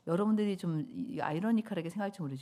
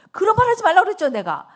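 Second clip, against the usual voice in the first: much higher, much louder exasperated cry; hammed-up yell of protest; mock outraged shout; 12 semitones higher, 15 dB louder, faster than usual. Preacher playfully scolding the Lord, in a loud high shout.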